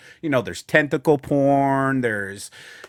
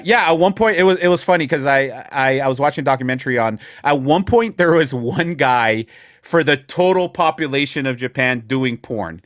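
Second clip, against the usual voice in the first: usual speaking voice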